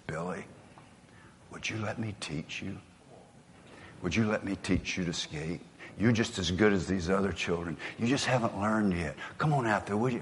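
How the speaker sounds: very soft, gentle coaxing invitation; tender coach. Retold or imitated. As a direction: kneel and coax a frightened child. Coach kneels, asks boy gently.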